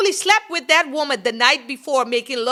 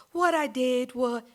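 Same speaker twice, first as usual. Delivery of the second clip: mock killer's soft, slow calm; creepy slow psychopath voice; 9 dB quieter than usual. Vlogger mocking the killer in court, eerie soft voice. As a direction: imitate her eerie courtroom drawl, softer. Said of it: "just talking like a real psychopath, real slow".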